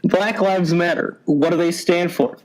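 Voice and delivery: ordinary talk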